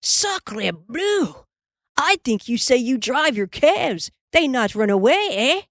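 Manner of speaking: put-on indignant boy with a French accent; French-accented sputter. A narrator's reading. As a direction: taunt him in a French accent. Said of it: "Pierre sputtered".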